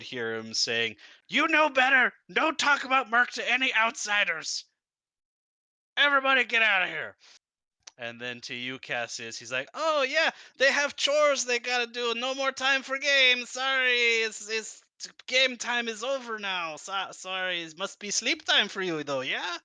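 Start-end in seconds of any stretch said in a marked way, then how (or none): 1.3-7.2 high, loud furious scolding; angry yelling. Acted. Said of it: "starts yelling in Draconic". 9.7-19.6 high, loud flustered cover-up; nervous NPC voice. Acted. NPC covering awkwardly to player.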